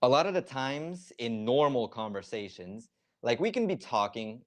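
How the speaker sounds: usual speaking voice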